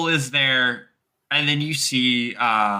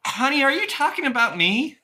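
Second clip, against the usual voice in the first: high-pitched Southern drawl; 11 semitones higher than usual. Acted. Girl called out by a stranger, answering in a Southern drawl. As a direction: lift it high in a Southern accent. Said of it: "draw Southern accent".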